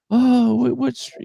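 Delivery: very high, eager cashier voice; supermarket lady. Retold or imitated. Cashier asking which street, very high and eager. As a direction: squeak it out like a nosy shop lady. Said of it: "the lady at the supermarket says".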